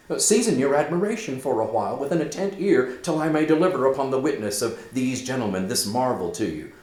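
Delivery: high, fast, pressing; urgent calming. Acted. Horatio steadying Hamlet before the tale, quick and urgent. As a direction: hurry to calm him.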